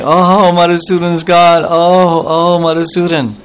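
high, mild acted wail of loss; a touch of tearful longing; crying out. Radharani mourning her absent beloved, mildly tearful high wail. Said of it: "she immediately started crying".

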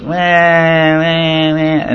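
mock whining baby cry. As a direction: imitate a baby fussing without urgency. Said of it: "whining because he, you know, he's just frustrated".

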